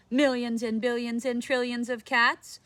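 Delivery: ordinary delivery, nothing marked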